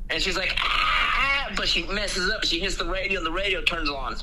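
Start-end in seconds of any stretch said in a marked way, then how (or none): 0.6-1.5 higher, hammed-up yelps; mock scream. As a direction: fake startled screams. Snake bites car window.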